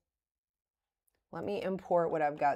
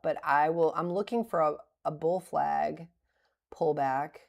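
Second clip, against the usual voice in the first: ordinary talk, nothing marked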